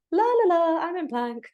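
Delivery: mock the breezy classmates; carefree la la la, teasing; mocking sing-song. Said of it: "everyone else being like".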